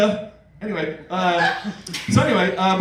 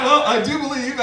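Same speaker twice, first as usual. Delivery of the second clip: ordinary delivery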